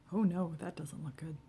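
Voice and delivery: usual speaking voice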